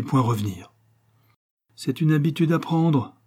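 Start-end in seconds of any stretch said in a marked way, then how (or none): none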